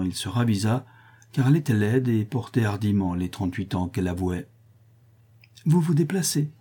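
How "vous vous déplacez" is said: amused question, pitched higher; souriant. A narrator's reading. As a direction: ask with an audible smile. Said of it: "dit-il en souriant". (amusé, en souriant)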